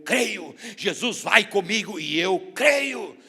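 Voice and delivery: loud, impassioned preacher's cry with long-held words; preaching at full force. Real, not acted. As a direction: proclaim it to the whole congregation. (pregação fervorosa, a plenos pulmões)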